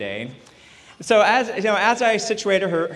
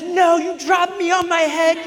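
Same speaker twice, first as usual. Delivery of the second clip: put-on high shouted child's accusation; mock furious daughter; angry toddler; 11 semitones higher, 5 dB louder than usual. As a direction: yell it like an outraged little girl. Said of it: "And she says".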